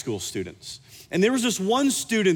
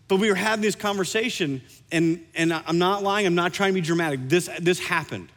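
ordinary delivery

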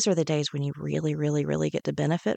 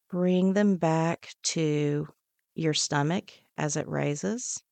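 guided meditation; 4 dB quieter than usual. Acted. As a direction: talk them down gently, one breath at a time. Leads a breathing meditation.

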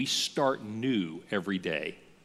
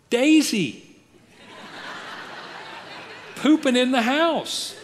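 laughing; 13 semitones higher, 5 dB louder, slower than usual. Real, not acted. Laughing at the dog's mischief.

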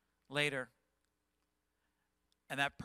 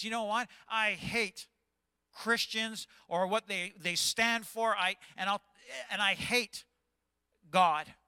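defiant quote; 5 semitones higher, 4 dB louder than usual. Retold or imitated. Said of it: "maybe your attitude this morning is".

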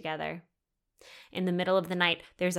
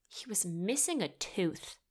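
softer, deadpan aside; muted disbelief; 6 dB quieter than usual. Real, not acted. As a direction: drop the volume on the absurd detail. First take, dropped low in volume.

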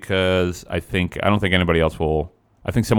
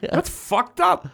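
high mock shock; much slower than usual. Real, not acted. Mock horror at trivia.

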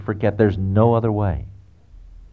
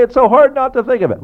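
much higher, whiny objection; mock complaining listener; 13 semitones higher, 4 dB louder than usual. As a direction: play the protesting churchgoer, pitched way up. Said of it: "But you say".

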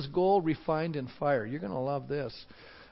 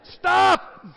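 high, loud yelled command; mock shout; 10 semitones higher, 7 dB louder than usual. Moses yelling at the crowd to stop, hammed-up. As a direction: cut them off with one loud word. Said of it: "That's actually what he said".

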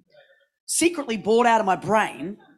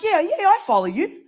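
breezy reassurance; 11 semitones higher, 6 dB louder than usual. Retold or imitated. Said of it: "And I'm like".

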